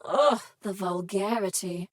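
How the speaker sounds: ordinary delivery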